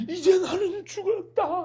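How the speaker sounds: high slow wailing despair; mock panic. A believer crying out in despair, in mock panic. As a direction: wail as if all is lost. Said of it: "이러면 좋은데".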